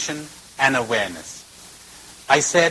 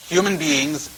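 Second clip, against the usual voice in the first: ordinary delivery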